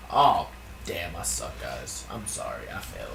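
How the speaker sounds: very low, quiet mutter; deflated aside. Real, not acted. Apologising to the guys for failing, deflated mutter. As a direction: drop right down into a defeated mumble.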